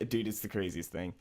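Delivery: usual speaking voice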